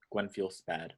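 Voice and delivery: ordinary talk, nothing marked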